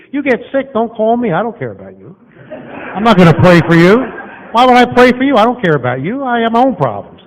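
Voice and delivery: loud, high mock rant of a preacher who doesn't care; mock uncaring preacher. Preacher mocking himself to the church, loud and uncaring. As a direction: play the selfish preacher, shouting it out.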